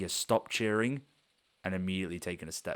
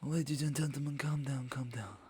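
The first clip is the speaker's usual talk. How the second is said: high whispery halfling announcing the winner; halfling announcer; 8 semitones higher than usual. Acted. GM voicing the halfling presenting the tournament winner, high and hushed. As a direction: hush the crowd like a sly little showman. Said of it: "comes out now and says".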